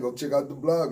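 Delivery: ordinary talk, nothing marked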